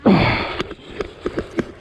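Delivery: straining grunt while pulling the rod; breathy grunt of effort. Real, not acted. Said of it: "Excuse me, even the grunting".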